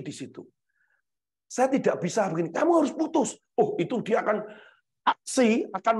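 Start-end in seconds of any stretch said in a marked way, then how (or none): 2.5-3.4 high, acted parental demand; mock stern order. Parent ordering child to break up, acted insistence. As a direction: lay down the law in a raised pitch.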